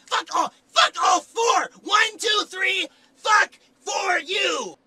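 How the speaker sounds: furious shout. Acted. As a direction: scream at the booth. Actor yells at the director.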